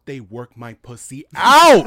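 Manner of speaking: loud excited. Real, not acted. Gushing about the Met Gala.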